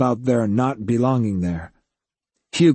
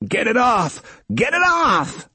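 high, frantic panic; panicked shriek; 11 semitones higher than usual. A narrator's reading. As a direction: shriek it, rising higher. Cable wrapped around his legs.

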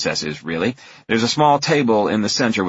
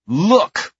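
put-on high, loud child's look; little girl command; 12 semitones higher, 5 dB louder than usual. A narrator's reading. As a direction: order him like a bossy kid. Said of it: "the older one says".

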